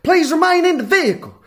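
high-pitched mock police announcement; American cop. Cops to tourists pulled over on the freeway, mock official order. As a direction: put on a cop ordering a driver. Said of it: "They were like".

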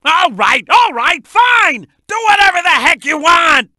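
irritated, rising surrender; exasperated giving in. Acted. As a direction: give up arguing with a pest, loudly. Gives in to Charmy, exasperated.

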